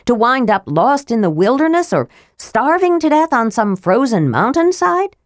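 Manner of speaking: high, challenging demand; Marita rounding on Tanis. A narrator's reading. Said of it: "She rounded on Tanis".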